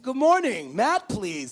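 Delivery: high, booming morning phone greeting; loud wake-up greeting. Retold or imitated. Staffer phoning a sleeping student at seven thirty, loud and chipper. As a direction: belt it out to jolt a sleeper awake.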